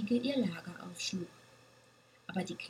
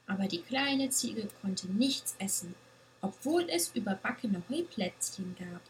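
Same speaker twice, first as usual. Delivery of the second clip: usual speaking voice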